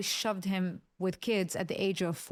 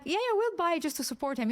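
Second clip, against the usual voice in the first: higher, humouring yeah-yeah as grown-ups playing along; indulgent friends; 8 semitones higher than usual. Friends at a business meeting humouring her son, higher indulgent voice. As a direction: humour the little boy sweetly. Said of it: "my friends are like".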